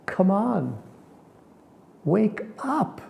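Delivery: high, pleading come on; inner rebuke. Retold or imitated. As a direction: shake yourself awake. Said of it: "and I thought".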